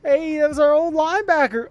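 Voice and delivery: surprised excitement. Real, not acted. Surprise at old player.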